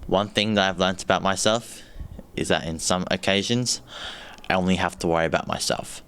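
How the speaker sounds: ordinary delivery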